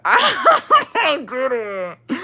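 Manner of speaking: high, loud laughing squeal; laughing denial. Real, not acted. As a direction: burst out laughing as you deny it. Laughs at the question, denying.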